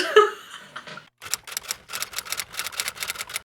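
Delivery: high airy laugh trailing off; long breathy laugh. Real, not acted. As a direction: let a long giggle fall away. Laughs after being moved.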